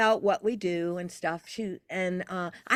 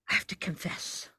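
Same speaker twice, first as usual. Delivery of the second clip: hushed, breathy-free whisper; imitating a stressed friend's whisper; guilty whisper; 5 semitones lower, 8 dB quieter than usual. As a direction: whisper it like a nervous secret. Stressed friend confessing in library.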